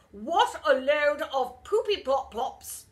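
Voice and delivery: loud, slow disgusted verdict; grumpy teacher. A narrator's reading. Stern teacher condemning the idea, loud and slow. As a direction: spit out the verdict.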